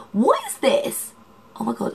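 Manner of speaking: real surprise at spotting a bump on her skin; surprised. Real, not acted. Vlogger suddenly notices a bite on her face, surprised.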